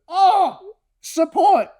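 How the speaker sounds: very high comic squeal of realization; amazed dog. Acted. Dog finding the trainer with it outside, high amazed voice. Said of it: "And the dog goes".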